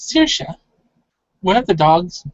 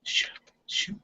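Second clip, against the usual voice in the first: short, hushed shooing sounds; imitated whispered shoo; 11 dB quieter than usual. Game master echoing the player's shooing, whispered. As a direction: whisper a quick little shoo. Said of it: "as you're making this kind of".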